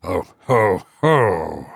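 low, hollow ho-ho-ho; goblin's mocking echo. A narrator's reading. Unseen voice close beside him, echoing the laugh deeply. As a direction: echo the laugh back in a deep voice. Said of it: "repeated a voice close beside him".

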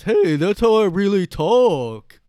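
very loud, high, slow protest; drawn-out oafish objection; dumb guy voice. Acted. Guy objecting to being made fun of, hammed-up dopey voice. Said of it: "you just do that voice".

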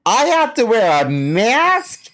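high, slow, drawn-out mock indignation; outraged Karen. Karen told to wear a mask, hammed-up tearful outrage. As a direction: wail each word as if gravely wronged. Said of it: "Or when they're like".

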